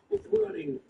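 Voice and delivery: very high-pitched fleeing cry; yelling figure. Acted. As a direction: squeal it like someone running off. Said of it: "it's yelling".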